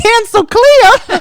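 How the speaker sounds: loud, high, drawn-out Southern cry; hammed-up Southern whoop; Southern accent. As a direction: holler it slowly in a Southern accent. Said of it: "we say it in a Southern accent".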